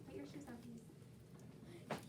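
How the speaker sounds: hushed, slowed-down classroom reminder; quiet aside. Real, not acted. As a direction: drop the voice and slow right down for a quick reminder. Teacher's quiet aside to a student.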